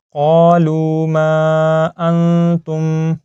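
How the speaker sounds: قَالُوا مَا أَنْتُمْ with "maa" held very long; long madd. Shown as a demonstration. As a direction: chant slowly, stretching the mim of مَا. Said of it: "میم کو ہم لمبا کریں گے". (مَا کے میم کو تین الف کھینچ کر)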